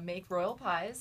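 ordinary delivery

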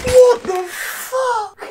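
high, drawn-out squeal through laughter; laughing disbelief. Real, not acted. Player stunned by the download size, laughing disbelief. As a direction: stretch it slowly, squeaking with laughter.

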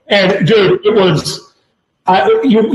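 ordinary talk

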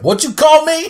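high, loud challenge; angry. Retold or imitated. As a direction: snap back in offense. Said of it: "And Frank's like".

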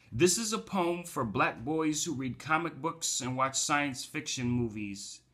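performed poetry reading; poem recital. Acted. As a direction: launch into the poem in a high, proclaiming voice.

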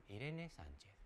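nombre casi inaudible, mucho más suave; lectura desganada. Retold or imitated. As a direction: murmura el nombre sin ganas. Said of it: "de la siguiente manera". (el señor del mir leyendo nombres casi sin voz)

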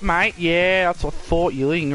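ordinary delivery

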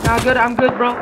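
usual speaking voice

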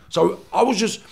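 ordinary delivery

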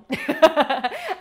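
high loud laugh at herself; bright burst of laughter. Real, not acted. Laughing at herself about the fridge, loud bright laugh. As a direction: laugh out at the silly thought.

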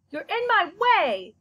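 slow, loud and high complaint. Acted. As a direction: push the words out loudly. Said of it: "Bat said loudly".

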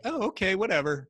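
a sarcastic, high, brush-off "whatever"; dismissive mockery. Mocking the doctor's dismissive reply.

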